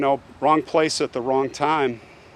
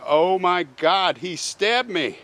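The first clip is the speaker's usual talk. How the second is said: retold screaming; 5 semitones higher, 4 dB louder than usual. Stabbed woman begs the neighbors for help, screaming in retelling. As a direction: imitate desperate screaming, rising to the final plea. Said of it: "She is screaming".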